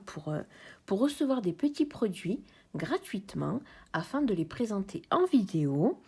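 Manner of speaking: ordinary talk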